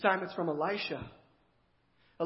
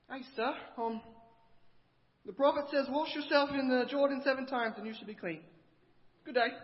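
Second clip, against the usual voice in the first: lightly voiced boy, higher and polite; a hint of a timid young servant at the door; messenger boy; 6 semitones higher than usual. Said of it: "then a messenger boy".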